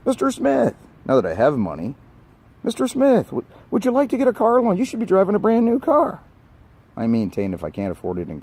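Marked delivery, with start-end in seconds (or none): 0.1-0.7 much higher, sugary salesman voice; mock bank clerk. Rants about bank staff pushing loans, high mock salesman. 2.6-6.2 much higher, sugary salesman voice; mock bank clerk. Rants about bank staff pushing loans, high mock salesman.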